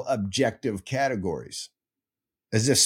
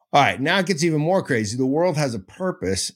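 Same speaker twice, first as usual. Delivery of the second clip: usual speaking voice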